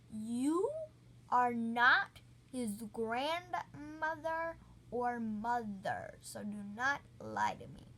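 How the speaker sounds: loud, slow stern telling-off; standing up to the lying woman at the door; firm scolding. Retold or imitated. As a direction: lay down the law slowly and loudly.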